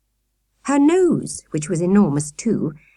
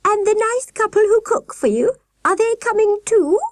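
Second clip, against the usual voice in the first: very high warbling voice from a giant woman; very high, trilling sweet voice; warbling falsetto nurse; 3 dB louder than usual. A narrator's reading.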